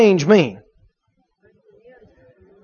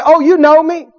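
lightly voiced caller, pitched higher; stranger at door; 4 semitones higher than usual. Unfamiliar caller answers through the door, coaxing. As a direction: claim to be known, a little higher. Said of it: "an unfamiliar voice comes back".

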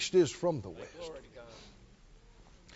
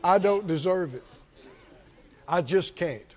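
lower, softer, hammed-up refusal; mock self-pity; 3 semitones lower, 3 dB quieter than usual. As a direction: decline as if unworthy, in jest. Mock child refusing a gift.

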